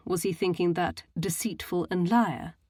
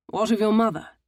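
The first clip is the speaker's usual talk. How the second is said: pushing; 4 semitones higher, 5 dB louder than usual. A narrator's reading. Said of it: "he pushed".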